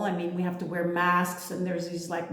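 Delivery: ordinary talk, nothing marked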